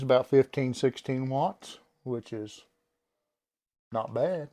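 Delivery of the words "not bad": usual speaking voice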